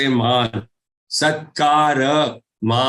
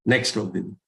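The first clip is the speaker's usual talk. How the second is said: quiet aside; 6 semitones lower, 6 dB quieter, much faster than usual. Real, not acted. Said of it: "aside to assistant".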